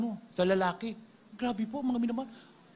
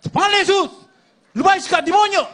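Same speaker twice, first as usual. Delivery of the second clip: yelled far higher and louder; commanding exorcism shout; 14 semitones higher, 9 dB louder, faster than usual. Retold or imitated. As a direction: cast the demon out with full force. Pastor casting out the demon. (sigaw ng pagpapalayas sa demonyo)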